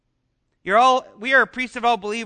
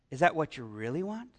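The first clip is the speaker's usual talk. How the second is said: low, soft tempting voice; a sly inner voice murmuring doubts; sinful nature; 8 semitones lower, 11 dB quieter than usual. Acted. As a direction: voice the doubting flesh, hushed and low.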